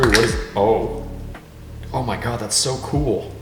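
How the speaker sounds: ordinary delivery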